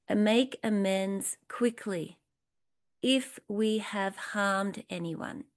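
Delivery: slow, deliberate reading of the line; slow emphatic reading. Shown as a demonstration. Teacher reads the book's line slowly to her group. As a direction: lean on each word to land it. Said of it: "It says".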